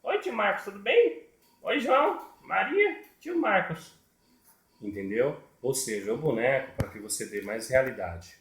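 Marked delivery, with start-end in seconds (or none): 0.0-3.9 very high doll voice; squeaky-high puppet hello; little puppet greeting. Acted. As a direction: pipe up as a puppet greeting each friend. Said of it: "Agora ela vai conversar com o tio Marcos".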